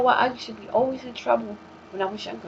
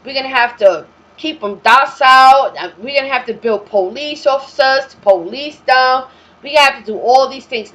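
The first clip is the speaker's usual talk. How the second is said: louder, higher scheming planner voice, hammed up; mock colonizer planning; 5 semitones higher, 10 dB louder than usual. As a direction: boom out their plan like a smug overlord. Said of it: "They had a plan, like".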